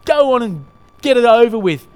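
loud, exasperated bellow. A narrator's reading. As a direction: roar it out as the fed-up ghost. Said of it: "bellowed the ghost".

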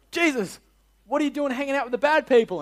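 exaggerated high-pitched protest; mock indignant questioning. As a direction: challenge him like a scandalised busybody. Acting the Pharisees questioning Jesus again.

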